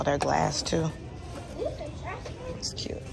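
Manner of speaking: ordinary talk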